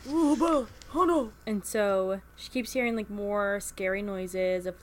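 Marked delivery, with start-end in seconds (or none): 0.1-1.3 high, loud mock wail; a hammed-up anguished angel calling Bella; distraught angel. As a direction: cry out in hammed-up despair. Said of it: "But no, it's like".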